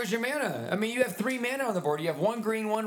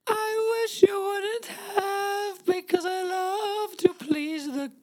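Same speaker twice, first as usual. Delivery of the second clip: higher, slow, whining; high whiny toaster; 7 semitones higher, much slower than usual. Acted. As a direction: plead like a sad appliance. Toaster pleading.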